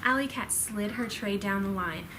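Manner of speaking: ordinary delivery, nothing marked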